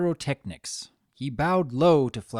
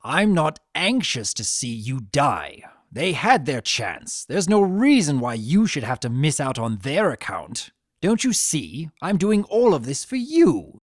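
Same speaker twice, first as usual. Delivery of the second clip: possessive rant; 6 dB louder than usual. Acted. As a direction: press her harder each line. Discord's possessive rant rising.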